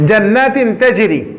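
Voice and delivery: slow chanted recitation; slow recitation demo. Shown as a demonstration. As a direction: draw the words out slowly. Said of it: "যেমন আসেন". (ধীর তেলাওয়াত)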